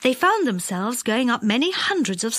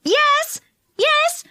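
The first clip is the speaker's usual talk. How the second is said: very high, eager calls; answering calls; 4 dB louder than usual. A narrator's reading. Six voices below answer Joe, calling high. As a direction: call back up like several voices. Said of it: "called six voices below him".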